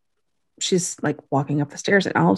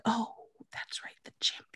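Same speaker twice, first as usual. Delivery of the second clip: near-whispered, faraway imitation; weird hushed remark; 4 semitones higher, 15 dB quieter than usual. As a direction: say it very softly, as if drifting. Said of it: "it's weird the way she says that".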